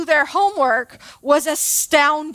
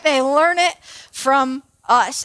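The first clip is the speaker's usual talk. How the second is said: slow, drawn-out delivery; slow emphatic repeat; 2 semitones lower, slower than usual. Real, not acted. Speaker driving home that kids copy adults, slow and deliberate. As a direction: space the words out and let the point land.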